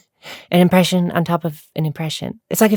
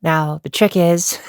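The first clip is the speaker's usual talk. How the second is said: ordinary talk, nothing marked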